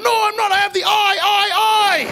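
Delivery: high, loud frantic pleading; frantic protest. Retold or imitated. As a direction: plead loudly to the crew. Protesting on plane.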